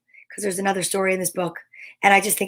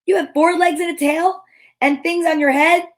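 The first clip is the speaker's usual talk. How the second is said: Morris voice; 8 semitones higher, 4 dB louder than usual. A narrator's reading. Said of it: "said Morris".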